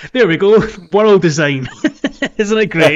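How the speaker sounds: loud, high, laughing praise; laughing delight. Real, not acted. Laughing at the car game, delighted and loud.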